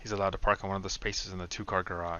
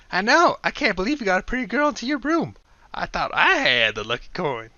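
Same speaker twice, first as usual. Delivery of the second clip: much higher, louder mocking tease; teasing Will; 11 semitones higher, 8 dB louder than usual. Will teasing Brian about the pretty girl, loud mocking. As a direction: rib him gleefully.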